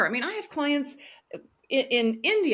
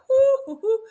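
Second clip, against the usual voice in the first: nervous laughing whoo; 8 dB louder than usual. Retold or imitated. Nervous puffing breath.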